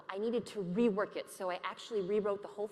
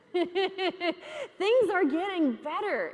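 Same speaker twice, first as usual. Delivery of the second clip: laughing; 8 semitones higher, 7 dB louder, much slower than usual. Real, not acted. Laughs at own recovery.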